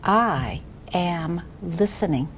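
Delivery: slow, word-by-word read-aloud; each word drawn out and separated; model reading. Shown as a demonstration. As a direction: read it very slowly for a beginner to echo. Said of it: "I read first, and then you read after me".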